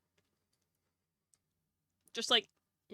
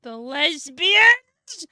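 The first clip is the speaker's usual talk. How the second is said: much louder, stretched out slowly; delighted surprise; 6 semitones higher, 16 dB louder, much slower than usual. Real, not acted. Reacting to her friend naming orcs, in delighted surprise. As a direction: drag it out in delight.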